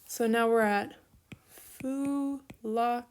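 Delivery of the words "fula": slow, drawn-out syllables of "fula-"; sounding out. Shown as a demonstration. Teacher building the future infinitive for students, sounding it out. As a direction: stretch out each syllable of "fula-". Sounding out a word being built.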